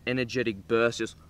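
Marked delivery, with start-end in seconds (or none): 1.0-1.1 gasp. Retold or imitated. Gasps describing energy burst.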